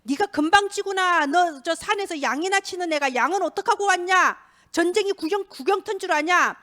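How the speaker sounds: acted big-brother telling-off; angry eldest brother. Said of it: "이러면서 오히려 화를 내면서".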